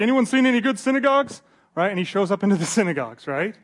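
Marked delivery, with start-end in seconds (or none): 0.0-1.4 high, loud call to anyone around; eager call-out. Acted. Paul off the boat asks around for synagogues, high eager call-out. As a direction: call it out brightly, pitch lifted high. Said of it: "and he's like".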